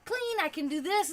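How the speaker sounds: high, loud, fast burst of eagerness; sudden energy. Retold or imitated. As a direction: rattle it off suddenly pumped up. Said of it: "And then you're like".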